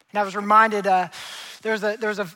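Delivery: ordinary talk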